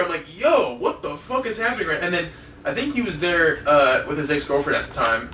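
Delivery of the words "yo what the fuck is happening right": retold bewilderment. Retold shocked reaction to yelling.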